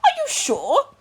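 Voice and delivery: anxious doctor. A narrator's reading.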